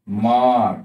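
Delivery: "মাঠ" with a long aa; drawn-out a. Shown as a demonstration. Teacher giving learners an example, drawn-out aa in "মাঠ". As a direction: hold the vowel in "মাঠ" before the final stop. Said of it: "আমরা টেনে উচ্চারণ করি".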